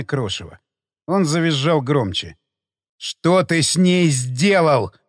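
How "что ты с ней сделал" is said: slow, hoarse, alien repeat; strange voice. Acted. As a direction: drag each word out hoarse. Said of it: "Чужим голосом повторил я вопрос".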